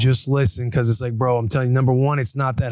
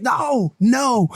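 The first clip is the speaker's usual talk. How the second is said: put-on high-pitched, drawn-out toddler refusal; upset toddler imitation; 9 semitones higher than usual. Crying toddler refuses her parents' truce, high imitated protest. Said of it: "She's like".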